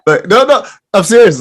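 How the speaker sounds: loud, high-pitched, insistent burst; excited urging. Real, not acted. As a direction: jump in loudly and urge them on. Excitedly urging guest to speak out.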